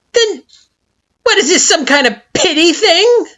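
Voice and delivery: very high, loud, sneering retort; suspicious Flowey. A narrator's reading. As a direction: demand it with a sneer.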